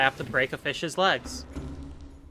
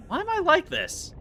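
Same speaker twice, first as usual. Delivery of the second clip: much higher squeaked question; squeaky self-mockery; 14 semitones higher than usual. Real, not acted. Streamer laughing at her own antics, high squeak. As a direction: squeak it at yourself in mock despair.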